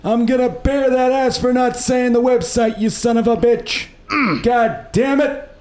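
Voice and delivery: put-on cranky old grandpa threatening a beating. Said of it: "I was doing grandpa voice".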